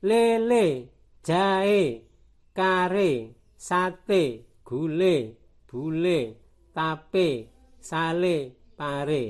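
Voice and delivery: words like "Lele" and "sate" with a pure é; teacher's list drilling é as in "Lele"; close E group. Shown as a demonstration. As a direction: read each word separately, stretching both syllables, final "e" as "ay". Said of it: "satu kelompok berbunyi E".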